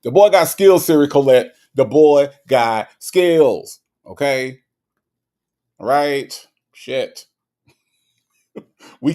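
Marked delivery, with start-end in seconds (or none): 0.0-3.8 loud drawn-out hype call; loud proud praise, slowing on the last line; hype shout. Real, not acted. Hyping rapper.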